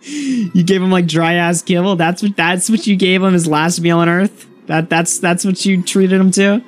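high-pitched giggling while quoting a friend's teasing; can barely get the words out for laughing; laughing through a retold quote. Retelling his friend's teasing about the dog's last meal, laughing hard.

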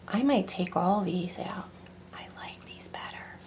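whispered aside to herself. Real, not acted. Crafter whispering to herself over the clay. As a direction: breathe it half to yourself.

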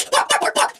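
high, rapid dog yaps; play-acted angry barking; wolf-girl barking. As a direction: bark at a rival like a dog.

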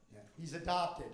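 ordinary talk, nothing marked

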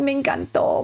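ordinary talk, nothing marked

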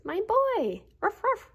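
high, loud happy bark; joyful barking. A narrator's reading. Dog reunited with his boy, barks high and loud. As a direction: greet your boy with delight. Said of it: "he barked".

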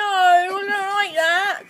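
slow, loud, revolted; disgusted while eating. Real, not acted. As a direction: push it out in disgust, mouth full. Disgusted tasting gross flavour.

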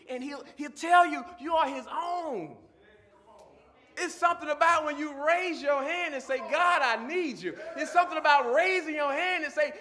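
full-force preaching. Real, not acted. Preacher urging listeners to raise a hand to God, full-force preaching. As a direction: drive it home to the whole congregation, loud and high.

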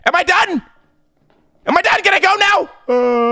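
high pleading scared shout; scared yelling. Real, not acted. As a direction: yell it out, terrified. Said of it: "I'm terrified".

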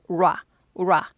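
"라" as "ura" squeezed into one beat; one-beat R onset. Shown as a demonstration. Teacher drilling the R sound, one beat. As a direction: glide from rounded lips into "라" in a single beat. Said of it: "우라를 한 박자 하는 거죠".

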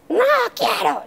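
muy agudo, imitando al niño caprichoso; niño berrinchudo. Retold or imitated. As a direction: haz el no del niño terco. (el hijo negándose a dar la manzana, agudo de niño)

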